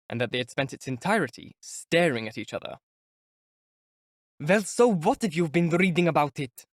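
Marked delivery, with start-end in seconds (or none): 4.4-6.7 bewildered impatient shout. A narrator's reading. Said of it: "he shouted suddenly in bewilderment and impatience".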